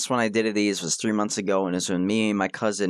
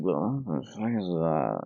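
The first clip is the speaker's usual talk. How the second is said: groggy, lower and softer mumble; half asleep; 5 semitones lower, 4 dB quieter than usual. Retold or imitated. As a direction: mutter it like you just woke up. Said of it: "I'm just half asleep".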